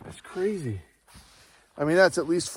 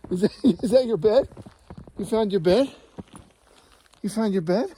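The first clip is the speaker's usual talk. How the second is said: higher-pitched, playful doggy talk; 5 semitones higher than usual. Real, not acted. Owner asking the dog about its found bed, playful pet voice. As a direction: coo teasing questions at your dog, voice lifted.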